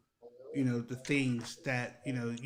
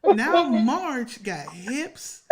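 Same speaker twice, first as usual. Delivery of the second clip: much higher, louder and very slow, relishing it; hyped punchline; 11 semitones higher, 10 dB louder, much slower than usual. Acted. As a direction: stretch out each word like a big reveal. Hyped Marge Simpson bit.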